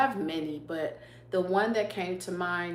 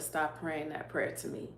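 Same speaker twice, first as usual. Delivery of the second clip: soft, gentle rebuke, quietly; hushed voice of God; 2 semitones lower, 7 dB quieter than usual. Retold or imitated. Speaking as God to her in prayer, hushed. Retelling God's words in prayer.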